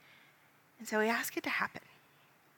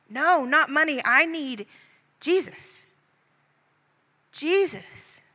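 higher, louder cry refusing the money; 7 semitones higher, 9 dB louder, slower than usual. Acted. Beggar throwing down the cup, crying out for Jesus. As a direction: plead for Jesus, not coins. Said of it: "you cry".

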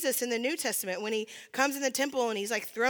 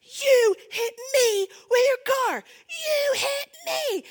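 slow, much higher and louder yell with a cry in it; outraged yell; 13 semitones higher, 6 dB louder, much slower than usual. Retold or imitated. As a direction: scream it at the driver through the window. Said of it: "I just start yelling".

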